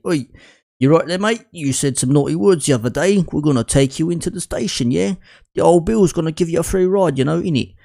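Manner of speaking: put-on jokey Cockney accent, a bit over the top; bad Cockney accent. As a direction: play a cheeky Cockney copper with a deliberately poor accent. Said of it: "it's my shit Cockney accent".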